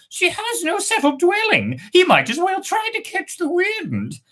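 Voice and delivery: very high squeaky bird question; first bird asking. Acted.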